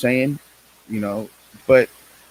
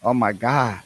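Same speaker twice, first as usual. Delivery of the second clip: ordinary delivery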